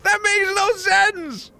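high-pitched, baffled protest; incredulous high wail; exasperated disbelief. Real, not acted. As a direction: protest it high, at a total loss. Outburst of disbelief at game puzzle.

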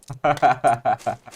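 rapid burst of laughter; hearty laugh. Real, not acted. Laughing with teammate.

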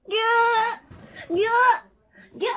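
cute hug sound. Acted. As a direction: stretch it out while hugging tight. Hugging plush. (甘えた声でぎゅーっと)

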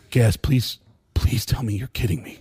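low, pleading voice of a dismayed friend; acted low dread; dismayed plea.

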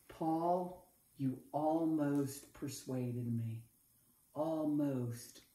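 soft, low and slow, with regret; sad near-admission. Acted. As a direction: let it sink away sadly. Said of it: "the most saddest thing".

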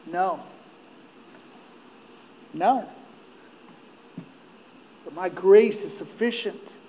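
low, soft, measured answer; quiet refusal. Acted. God answers Paul's plea for deliverance, quietly firm. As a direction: answer softly, leaving space after each word.

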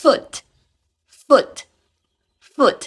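"foot" with short vowel, final t; model pronunciation. Shown as a demonstration. Teacher models "foot" for students, model pronunciation. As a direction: pronounce "foot" short, ending on a crisp t. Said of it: "ฝึกอ่านออกเสียงสามคำนี้".

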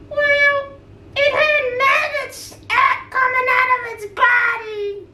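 puppet voice. Pretend. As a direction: play a cheerful little puppet.

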